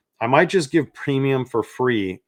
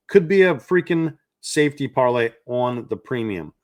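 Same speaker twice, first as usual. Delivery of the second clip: normal talking voice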